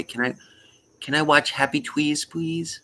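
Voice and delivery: put-on high-pitched toddler plea; little child. Stepchild asking to watch at bedtime, imitated toddler voice. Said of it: "middle stepchild".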